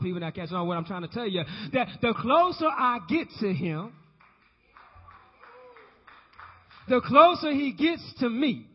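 loud, climbing preacher's call; rousing sermon peak; full-force preaching. Acted. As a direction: preach it out to the congregation.

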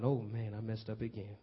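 mock crying. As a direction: play Peter's broken regret, hushed and low. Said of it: "Now I'm crying".

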